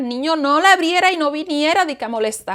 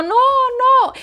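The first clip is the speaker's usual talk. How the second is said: high desperate no; high panicked protest; pleading; 10 semitones higher than usual. Retold or imitated. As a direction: cry out in protest, voice up. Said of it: "y yo".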